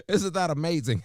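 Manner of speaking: high, loud gushing, laughing through it. Acted.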